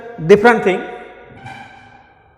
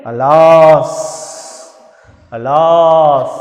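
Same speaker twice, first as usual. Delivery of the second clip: sorrowful alas; 4 semitones lower, 4 dB louder, much slower than usual. Shown as a demonstration. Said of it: "Exclaim with said".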